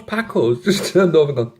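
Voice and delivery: usual speaking voice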